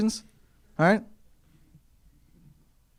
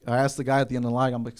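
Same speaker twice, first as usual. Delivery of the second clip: ordinary talk, nothing marked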